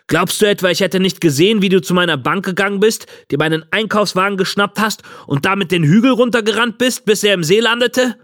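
heated, high-pitched accusation; angry old man. A narrator's reading. Old man to the boy who took his trolley, ranting angrily. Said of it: "brüllte der alte Mann".

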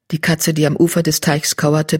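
ordinary delivery, nothing marked

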